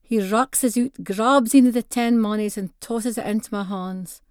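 ordinary delivery, nothing marked

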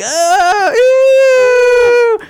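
put-on high, loud disgusted shriek of kids. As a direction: squeal like grossed-out children. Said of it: "they were like".

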